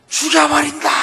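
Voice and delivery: high, loud mimicked echo; echoed angry yell. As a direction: send the furious shout back as an echo. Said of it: "무슨 소리가 들리겠습니까". (고함이 되돌아오는 메아리 흉내)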